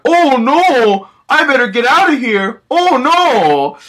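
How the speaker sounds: high, loud panicked squeal. Retold or imitated. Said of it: "I was going like".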